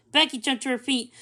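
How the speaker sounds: usual speaking voice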